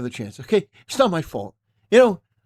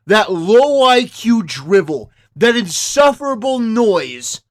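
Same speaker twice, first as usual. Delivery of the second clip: rousing speech; 3 semitones higher, 9 dB louder, slower than usual. Acted. Rousing victory speech to viewers.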